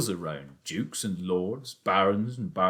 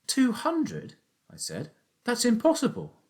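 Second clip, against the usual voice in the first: put-on much higher, disbelieving boy; amazed boy. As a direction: gasp at an impossible idea like a child. Danny amazed at father's plan.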